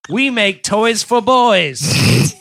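loud, high, drawn-out jingle-style announcer; hammed-up old-time pitchman; mock advertising slogan. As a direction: belt out a silly toy slogan, stretching the words.

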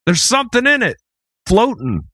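high panicked warning, hammed-up; mock alarm. Host pretending to warn everyone out of the pool, mock panic. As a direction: sound the alarm like a lifeguard.

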